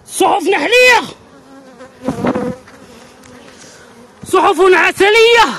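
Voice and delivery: high, ringing announcement; proud proclamation. Real, not acted. Beekeeper showing honeycombs to viewers, proudly exclaiming. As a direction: proclaim it high, drawing out the words.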